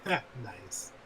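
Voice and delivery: low, drawn-out joking "Nice."; low meme nice. Pretend. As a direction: drop the voice low and say it knowingly. Echoing the sixty-nine meme.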